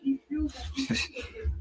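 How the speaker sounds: sudden high-pitched laugh. Real, not acted. Laughing at the home-crowd point, high burst. Laughing at his own remark.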